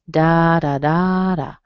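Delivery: "Da, da, da, da" as DA-da-DAA-da; stress pattern demo. Shown as a demonstration. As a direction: beat out first and third syllables long in "Da, da, da, da". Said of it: "hear the first vowel and the third vowel".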